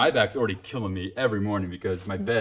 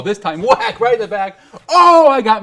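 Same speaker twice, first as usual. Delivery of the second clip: excited laughing retelling; 12 semitones higher, 13 dB louder than usual. Retold or imitated.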